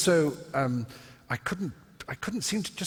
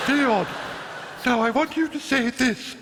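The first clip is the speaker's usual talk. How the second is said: put-on very high, louder ingressive old lady; breathing-in voice; 13 semitones higher, 4 dB louder than usual. Elderly coach instructing her pupil, in a strange breathing-in voice. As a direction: speak while sucking air in, pitched high. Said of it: "it was that sort of strange voice".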